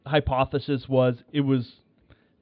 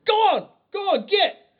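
high, sharp shoo-away call; shooing yell; much slower than usual. Retold or imitated. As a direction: chase the animal off.

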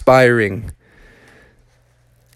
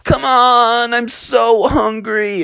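put-on high, drawn-out child whine; whining kids; 10 semitones higher, slower than usual. A narrator's reading. Hungry kids keep begging Dad, high drawn-out whine. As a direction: wheedle slowly, pitched way up. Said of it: "the kids were whining so much".

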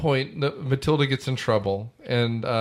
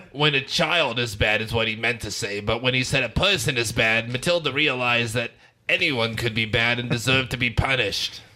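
hammed-up New Jersey accent; mock Jersey tough-guy reading; 2 semitones lower than usual. Shown as a demonstration. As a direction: retell it in thick put-on Jersey. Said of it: "get more Jersey in that reading".

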